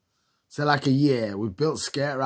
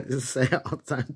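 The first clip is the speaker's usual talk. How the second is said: ordinary talk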